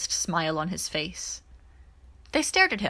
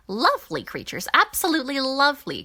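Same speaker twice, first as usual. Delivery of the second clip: gushing delight; 6 semitones higher, 4 dB louder than usual. Acted. Coran gushing over the creatures.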